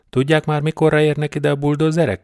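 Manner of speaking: high, loud yell over the rain; shouting into the radio. A narrator's reading. Sergeant shouting into the police radio, loud and high. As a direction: bark it into the radio, urgent. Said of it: "kiabált a rádióba".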